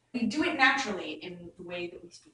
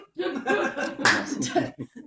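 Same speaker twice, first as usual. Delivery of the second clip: amused laugh; 5 semitones higher, 4 dB louder than usual. Real, not acted. Laughs at the bad quarto line.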